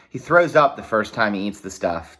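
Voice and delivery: ordinary delivery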